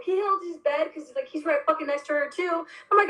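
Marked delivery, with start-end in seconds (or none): none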